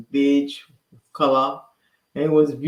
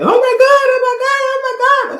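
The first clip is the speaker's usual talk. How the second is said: high, loud excited yell; retold yelling; 9 dB louder than usual. Sister on speakerphone with news, yelling. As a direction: yell it out frantically, pitch way up. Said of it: "she stopped yelling".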